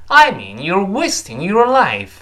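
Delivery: "I mean you're wasting your life" slow, high, hitting "wasting" and "life"; key-word stress. Shown as a demonstration. Teacher voices the first person's answer, "wasting" and "life" stressed high and loud. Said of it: "wasting和life在这一定是重读的".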